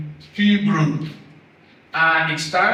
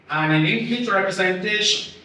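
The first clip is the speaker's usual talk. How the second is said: ordinary talk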